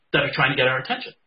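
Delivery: ordinary talk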